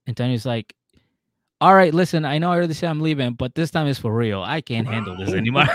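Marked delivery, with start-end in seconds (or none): 1.6-5.7 raised, louder start easing off; acted-out end of patience; fed-up announcement. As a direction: announce leaving in mock exasperation. Acting out fed-up woman leaving.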